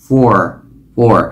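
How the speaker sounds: "For" said in full, f with a clear or; strong "For" like four. Shown as a demonstration. Teacher repeating the full form: "For" in full. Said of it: "phát âm đầy đủ là for".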